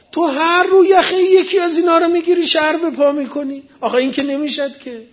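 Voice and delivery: high-pitched, exasperated mock reproach; acted scolding. Moses tells the troublemaker this cannot go on, acted scolding.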